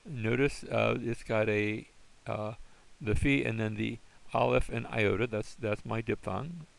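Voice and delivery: usual speaking voice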